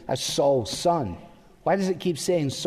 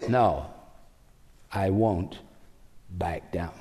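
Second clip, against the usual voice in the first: low, stubborn refusal; defiant Saul; 9 semitones lower, 3 dB quieter, slower than usual. Retold or imitated. Saul refusing to yield the throne, low defiant refusal. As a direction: dig in and refuse, pitch dropped low. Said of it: "say with Saul".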